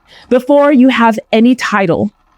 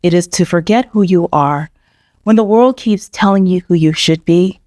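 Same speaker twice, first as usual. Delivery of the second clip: calm, lower scripted intro read; podcast intro; 5 semitones lower than usual. Acted. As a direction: read it like a podcast host opening the show.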